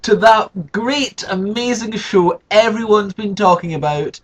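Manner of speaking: grand slow showman welcome; show host intro. Acted. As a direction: announce the show like a big TV presenter.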